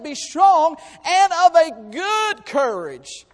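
loud, high, rising preacher's cry; preaching at full force. Acted. Preacher urging the church to courage, full force. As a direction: drive the point home to the congregation.

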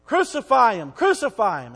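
preacher voicing the crowd demanding crucifixion, loud; mob shout. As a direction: hurl it as the angry mob.

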